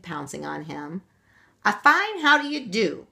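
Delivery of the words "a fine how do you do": high, loud indignant cry; leprechaun's cry. A narrator's reading. Caught leprechaun crying out at the children. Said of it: "cried the little cobbler".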